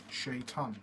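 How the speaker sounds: ordinary delivery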